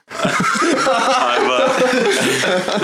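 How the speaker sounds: high laugh breaking through words; cracking up mid-sentence. Real, not acted. Player laughs at the answer.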